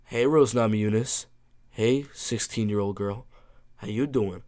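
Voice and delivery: lightly put-on low, soft come-on; Pat Barry. Streamer doing a requested fighter impression for chat, lightly voiced Pat Barry. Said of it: "Pat Barry impress-, impression?".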